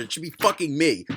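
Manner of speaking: ordinary talk, nothing marked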